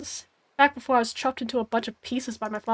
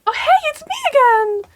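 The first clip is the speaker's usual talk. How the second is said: much higher, louder cartoonish guide; cheery narrator voice; 7 dB louder than usual. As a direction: pop in as a chirpy game guide. Game narrator voice pops back in.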